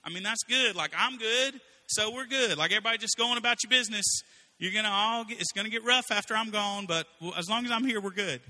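high, breezy complacent king; lightly mock a king who shrugs off the future; careless king. Preacher imagining himself as king, high breezy shrug. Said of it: "I'd be like".

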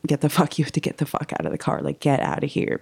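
whispered mock scream; urgent whisper, no voice behind it; little inner voice screaming. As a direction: hiss it in a whisper like a frantic inner voice. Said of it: "little voice inside of me, um, started screaming".

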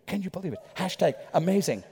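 low, soft murmur of amazement; hushed aside, as if tweeting it; hushed onlookers. Acted. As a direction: act out astonished guests under their breath. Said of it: "everything goes to a hush".

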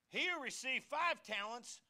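preaching. Acted. Pastor reading verse sixteen to the congregation, slow and loud. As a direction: declare each word of the verse.

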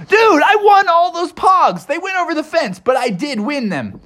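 very high, insistent frog voice; Froggy the excited frog. A narrator's reading. Froggy claims the pogs he won, high excited frog voice. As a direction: squeal it as a little frog.

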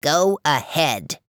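slow crackling rasp; raspy fire voice. Acted. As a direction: drag it out like crackling flames. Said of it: "his raspy and crackling voice".

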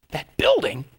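high, scoffing retort; mock disgust at the old building; scornful disbelief. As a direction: sneer at the idea. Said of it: "I said".

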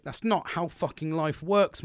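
re-enacted indignant lecture; indignant telling-off. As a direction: spell it out to a wrongdoer. Retold car park quarrel, scolding.

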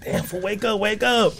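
high, held-back mock panic; mock alarm. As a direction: imitate trying to rouse someone passed out. Acting out waking passed-out homie.

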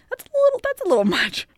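high, fast, laughing. Real, not acted. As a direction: squeak it out through a laugh. Outraged laugh after the firebombing.

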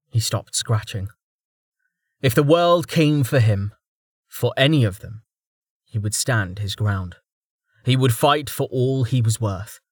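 ordinary delivery, nothing marked

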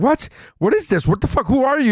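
high confused Avengers; mock Avengers. Avengers baffled by an intruder, high mock confusion. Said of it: "they're like".

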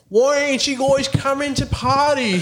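high-pitched mock Aussie accent; thick Australian accent. Aussie woman challenging the guys at a party, thick mock Australian accent. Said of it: "like, thick Australian accent".

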